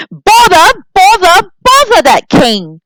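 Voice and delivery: high, loud fed-up grumble; annoyed. Acted. Charlie cross that the king cancelled, loud fed-up huff.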